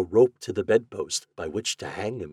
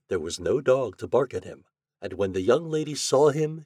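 ordinary delivery, nothing marked

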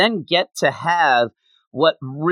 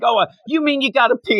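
higher-pitched, lightly voiced innocent asking; mock naive question; 11 semitones higher, faster than usual. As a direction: pitch it up, playing puzzled. Retold Goku's naive question.

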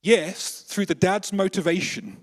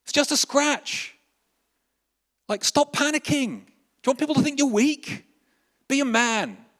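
much higher, loud scolding father voice; harsh dad; 10 semitones higher, 3 dB louder than usual. Acted. Dad scolding his panicking son, loud and high. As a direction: bark at the crying boy like a tough dad. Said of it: "his dad comes to him and say".

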